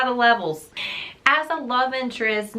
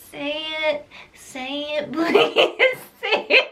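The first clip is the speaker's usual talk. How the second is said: climbing higher with each plea, breaking into a laugh; mock whiny begging; 4 semitones higher than usual. Mocking Dorian begging her to say his name, whiny and rising. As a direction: whine it like a needy child, laughing through it. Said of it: "he was whining".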